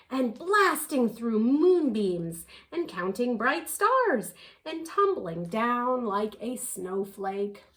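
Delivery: ordinary delivery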